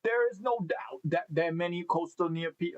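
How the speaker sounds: amateur Australian accent attempt. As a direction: try the Australian accent again and break off. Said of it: "Should I say that in an Australian accent?".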